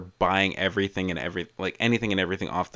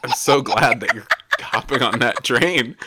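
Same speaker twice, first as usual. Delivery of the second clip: laughing; 2 semitones higher, 3 dB louder than usual. Retold or imitated. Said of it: "I'm like".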